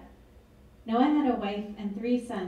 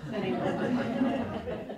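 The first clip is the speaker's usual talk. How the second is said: laughing; 2 semitones higher, much slower than usual. Real, not acted. Reader jokes about reading everything.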